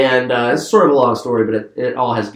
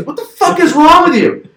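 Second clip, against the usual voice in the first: much higher and louder, appalled yell; disgusted outburst; 7 dB louder than usual. Retold or imitated. Brother yelling at the storyteller, disgusted outburst. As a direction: blast him with disbelief.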